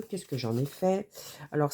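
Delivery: usual speaking voice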